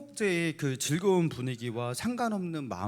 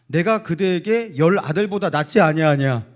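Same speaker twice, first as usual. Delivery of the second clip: proud boast; 3 semitones higher, 9 dB louder than usual. Acted. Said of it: "굉장히 위험한 발언을 합니다".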